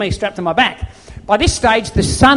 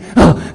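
one big louder gasp for air; washed-up swimmer's heaving gasp; exhausted gasp; 3 semitones lower, 5 dB louder than usual. Retold or imitated. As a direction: gasp like you just crawled out of the sea. Collapsing exhausted on the shore.

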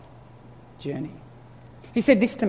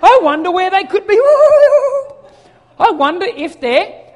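high, loud playful seeking through laughter; laughing while searching; 14 semitones higher, 9 dB louder than usual. Retold or imitated. Dad searching for kids, laughing playfully. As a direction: play dumb while giggling. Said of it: "playful game with kids".

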